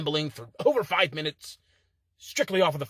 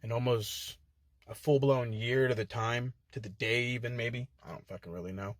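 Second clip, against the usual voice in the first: fading aside; 7 semitones lower, 7 dB quieter than usual. Real, not acted. Trailing reflective aside.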